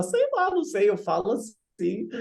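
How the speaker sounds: a shrugging imitation of the coworker; mock casual reply from coworker; imitating coworker. Retold reply of coworker Chelsea.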